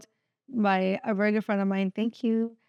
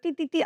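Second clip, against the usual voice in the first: higher mock alarm beeps; imitated car beeps; 6 semitones higher than usual. Imitating her car's warning beeps, higher. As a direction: beep like a sensor.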